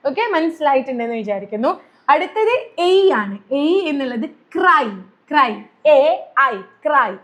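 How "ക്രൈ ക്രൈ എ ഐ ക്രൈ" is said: ക്രൈ drawn out slowly, gliding from a to i at the end; ai sound demo. Shown as a demonstration. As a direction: stretch ക്രൈ so both the a and the i sound are heard. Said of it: "ഒരു എ സൗണ്ടും ഐ സൗണ്ടും എൻഡിങ്ങിൽ".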